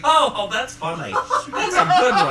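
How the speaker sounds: high, loud laughing dismissal. Pretend.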